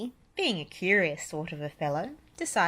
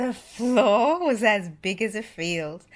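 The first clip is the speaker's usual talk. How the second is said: slow and amazed; 3 semitones higher, 7 dB louder than usual. A narrator's reading. Awed narration of huge room.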